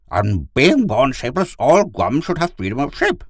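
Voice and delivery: high, loud dog character; earnest talking dog. Acted. Gur argues for freedom of shape, earnest dog.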